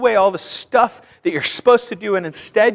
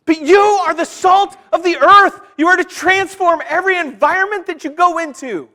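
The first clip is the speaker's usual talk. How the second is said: full-force preaching; 9 semitones higher than usual. Acted. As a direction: preach it out, urging the church to shine.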